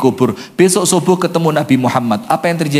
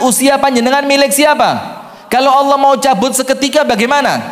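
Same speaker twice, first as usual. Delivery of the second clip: fervent preaching; 8 semitones higher than usual. Acted. Preacher challenges the congregation, raised and fervent. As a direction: press the congregation with each question.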